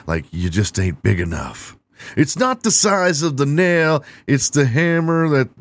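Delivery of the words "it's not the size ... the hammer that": much higher, punchy delivery of a saying; high proud proverb. Real, not acted. Comedian delivers a botched proverb much higher.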